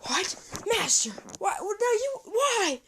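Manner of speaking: high, loud cries of disbelief; shocked protest. Acted. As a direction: plead with the master, stunned. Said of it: "Shigaraki's just like".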